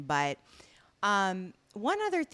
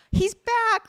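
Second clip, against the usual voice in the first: high, loud proclamation; loud, pitched-up announcing; mock dramatic announcement; 12 semitones higher, 9 dB louder than usual. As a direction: burst out with it like a sudden reveal. Acting out the abrupt revival.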